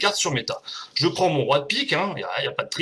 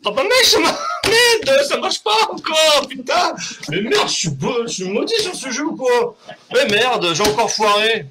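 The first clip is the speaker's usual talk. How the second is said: real angry shouting, higher and louder; furious outburst; 7 semitones higher, 5 dB louder than usual. Real, not acted. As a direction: rant at the failed trick in a rage. Trick fails, he rages. (crise de colère criée, plus aigu et plus fort)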